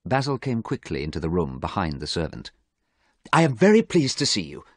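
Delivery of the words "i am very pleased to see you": higher-pitched voice of a concerned friend; lightly acted earnest friend. A narrator's reading. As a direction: greet him with relief. Worried friend greets Dorian.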